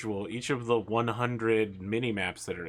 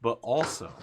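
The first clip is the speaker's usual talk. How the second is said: usual speaking voice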